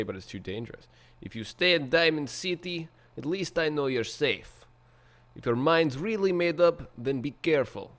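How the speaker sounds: put-on a light Russian accent, steady. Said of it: "my terrible, terrible Russian accent".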